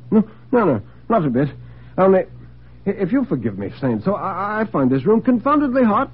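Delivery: nervously polite, voice lifted and halting; flustered dinner guest. Acted. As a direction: brush off concern, uncomfortably. Bedford uneasy, denies being unwell.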